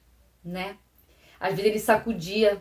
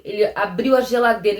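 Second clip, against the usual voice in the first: usual speaking voice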